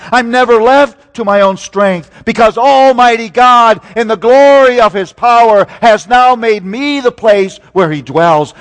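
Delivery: loud, high preaching build; rising pulpit fervour, loud and high; full-force preaching. Real, not acted. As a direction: lift it up and preach it out. Preaching crescendo.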